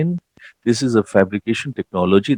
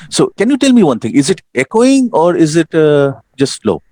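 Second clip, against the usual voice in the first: raised, projecting lecture voice; speaking louder; 6 semitones higher, 4 dB louder than usual. Real, not acted. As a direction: push the voice out over a bad line. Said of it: "try to shout louder".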